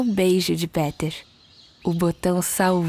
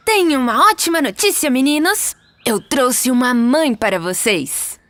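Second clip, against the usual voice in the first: higher voice, proudly breaking good news; excited announcement; 4 semitones higher, 3 dB louder than usual. Acted. Peter tells the boys he brought a mother, excited announcement. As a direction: announce it brightly, slowing on the surprise.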